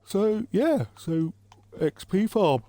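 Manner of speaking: words stretched by a yawn. Real, not acted. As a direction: talk through a real yawn. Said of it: "Oh, excuse me, with yawns again".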